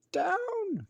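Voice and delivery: high, loud cry of Down; witch crying out. A narrator's reading. Witch yelling to her cat to land. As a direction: shout it like a startled witch. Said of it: "cried the witch".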